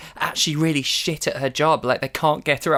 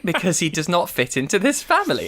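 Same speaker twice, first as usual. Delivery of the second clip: ordinary talk, nothing marked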